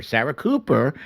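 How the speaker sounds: ordinary talk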